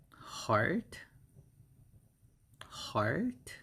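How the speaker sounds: HEART as one careful syllable with rising tune; model pronunciation. Shown as a demonstration. Teacher showing how heart is said, careful rising model. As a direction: model HEART for learners, lifting the pitch at the end. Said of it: "like that".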